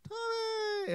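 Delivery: Tommy sung out high and falling; high called name. Shown as a demonstration. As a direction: call "Tommy" high like kids answering. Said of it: "you're gonna say".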